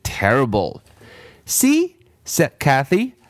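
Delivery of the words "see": very high smug "See?"; triumphant child; gloating girl. Acted. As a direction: crow over being right. Kathy voiced high.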